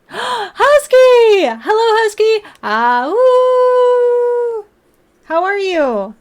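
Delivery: high, loud, delighted squeal of a hello; excited greeting. Real, not acted. Greeting a viewer's gift excitedly.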